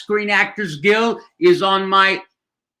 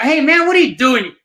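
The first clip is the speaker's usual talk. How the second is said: high-pitched imitation of an irritated friend; mock annoyed challenge; 8 semitones higher, 4 dB louder than usual. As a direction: call out an exasperated poker buddy's complaint.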